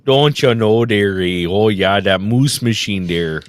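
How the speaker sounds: put-on Canadian accent. Mock Canadian follow-up bit.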